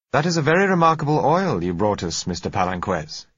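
company director keen on the sample, lightly voiced; managing director. A narrator's reading.

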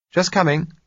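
calling from another room; woman calling out. A narrator's reading.

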